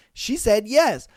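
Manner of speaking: excited. Retold or imitated. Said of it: "he's just like".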